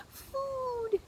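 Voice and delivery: very high, tiny peeping cry; squeaky gosling cry. A narrator's reading. Newborn gosling crying out to Roz for food. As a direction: squeak it out like a hungry chick.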